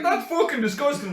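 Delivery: retold disgust, pitched high and emphatic; disgusted reaction. Retelling a horrifying video to a friend, disgusted. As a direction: blurt it out in mock disgust.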